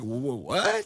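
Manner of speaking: stammered, imitated double take; mock shock, tripping on the word. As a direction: act out a startled stutter. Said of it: "he was shocked".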